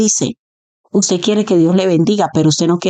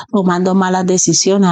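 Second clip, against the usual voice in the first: ordinary talk, nothing marked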